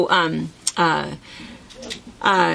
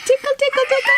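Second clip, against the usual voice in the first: giggly tickle attack; faster than usual. Acted. Tickling the baby.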